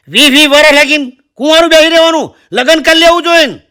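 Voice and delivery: high, loud rustic uncle outrage; shocked old uncle. Retold or imitated. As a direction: scold her like a stunned old uncle. Old uncle scolds unmarried girl.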